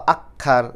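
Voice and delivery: আক্ষার with a doubled k sound; common mispronunciation. Shown as a demonstration. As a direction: say আক্ষার as "akkhar", no m. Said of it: "এরকম টাইপের উচ্চারণ করার চেষ্টা করি".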